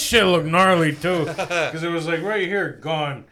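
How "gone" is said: usual speaking voice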